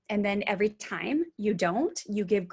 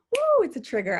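worked up; 5 semitones higher, 7 dB louder than usual. Real, not acted. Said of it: "fire comes out of my head".

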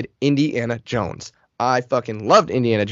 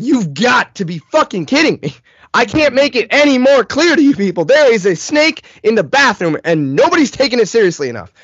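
high, loud, frantic outburst; hammed-up furious disbelief; exasperated rant; 9 semitones higher, 5 dB louder than usual. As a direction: rail at everyone for not listening. Rant at dad not understanding.

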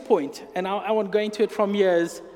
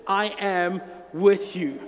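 usual speaking voice

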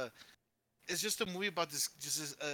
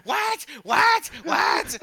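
high, loud mock shrieks, repeated; outraged mom shrieks; 12 semitones higher, 13 dB louder, much slower than usual. Imitating the friend's mom over the phone, outraged shrieks. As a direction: imitate a mother exploding in disbelief. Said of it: "all you hear from the phone is like".

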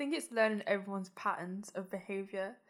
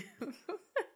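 high laugh; 9 semitones higher, 7 dB quieter than usual. Real, not acted. Laughing at the greeting.

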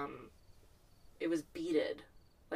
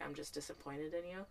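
disappointed mom; 10 dB quieter than usual. Retold or imitated. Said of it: "like a mom saying".

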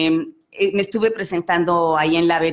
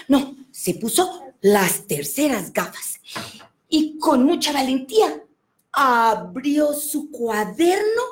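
lively, animated storyteller voice; playful children's storyteller; storytelling to children; 8 semitones higher, slower than usual. Acted. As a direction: tell it like you're reading to a room of kids. Tells children's story on request.

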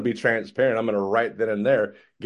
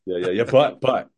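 usual speaking voice

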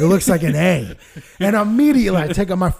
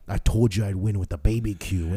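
much lower, softer gloating; smug low taunt; 10 semitones lower, 8 dB quieter than usual. Retold or imitated. Son gloating to dad after winning, low and soft.

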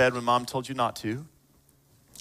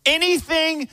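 full-force preaching; 10 semitones higher than usual. Real, not acted. Preacher telling the congregation that this is the gospel, impassioned preaching. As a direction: drive each phrase home with raised voice.

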